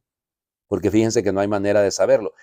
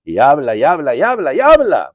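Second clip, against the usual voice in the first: mocking endless talking; 6 semitones higher, 8 dB louder than usual. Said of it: "nunca aterriza el Padre".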